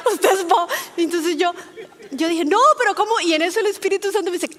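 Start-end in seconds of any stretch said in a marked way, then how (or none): 0.1-0.7 a gentle fatherly rebuke, louder, through laughter; subtle father's voice. Retold or imitated. The Spirit gently scolding her, fatherly and amused. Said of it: "esa voz sutil de padre". 2.4-3.2 higher and louder, in disbelief; startled protest. Retold or imitated. She protests to the Spirit, surprised and higher.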